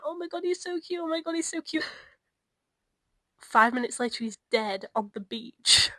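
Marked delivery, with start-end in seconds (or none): 0.0-1.9 higher gushing squeal, Oh my god repeated. Retold or imitated. Retelling texting a friend about Dobby, gushing higher. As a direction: gush like a fangirl over a cute elf. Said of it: "texting Liv being like".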